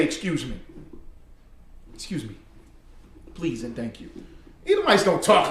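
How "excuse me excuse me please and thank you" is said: dainty, overly polite "Excuse me", much softer; mock polite voice. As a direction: mimic prissy good manners, quiet and proper. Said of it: "I heard an Edomite say".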